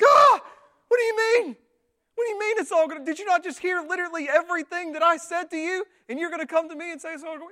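loud, high-pitched acted outburst; hammed-up panicky indignation; exasperated freak-out. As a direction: explode in disbelief at the comforter. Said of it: "And I'm like".

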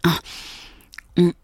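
normal talking voice

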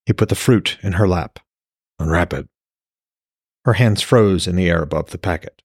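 steady storyteller reading aloud; calm novel narration; story narration. A narrator's reading. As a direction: read the chapter like an audiobook. Author reads novel aloud.

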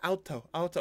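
imitated toddler. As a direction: mimic a small child pointing things out. Imitates German toddler calling out.

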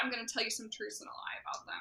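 fast secretive whisper. Real, not acted. Teasing secret reveal.

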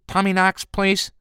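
high, tight pinched voice. Acted. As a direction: squeeze it through a tight throat. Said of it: "said a pinched voice".

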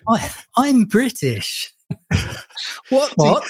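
mock posh English voice, a hint of laughter; mock posh British. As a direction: lightly send up the posh Brit. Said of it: "they normally sort of speak".

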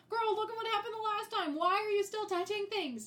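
loud, high-pitched mock telling-off; exasperated scolding. Viewer scolding Alice for touching things, exasperated. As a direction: scold her in exasperated disbelief. Acted scolding of film heroine.

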